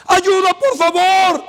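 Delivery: slow, high, loud plea for help; pleading shout. Retold or imitated. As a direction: beg for help across the camp. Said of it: "un hombre empezó a gritar". (súplica gritada y lenta)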